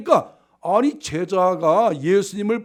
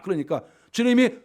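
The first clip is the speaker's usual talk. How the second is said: usual speaking voice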